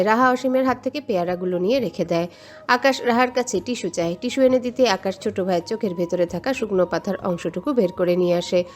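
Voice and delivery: ordinary delivery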